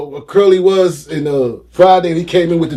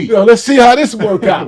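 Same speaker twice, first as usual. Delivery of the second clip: raised pitch and louder, jumping at it; eager reply; 7 semitones higher, 5 dB louder than usual. Retold or imitated. Hustler answering the Maryland offer, loud and eager. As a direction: retell it as a keen yes.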